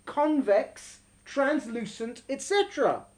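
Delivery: slow, measured teaching read; emphatic reading aloud. Acted. Teacher reading a text on prophecy to students, deliberate emphatic reading.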